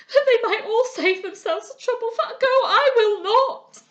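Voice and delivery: tearful defiance. A narrator's reading. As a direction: dig in your heels, weeping. Refuses to leave friend.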